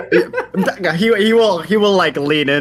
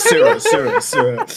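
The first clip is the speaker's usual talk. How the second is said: echoing name through a laugh, jumping higher; echo imitation. Player mimics the name echoing off metal, laughing echo. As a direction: play the metal echo, amused. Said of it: "You just hear the freaking echo".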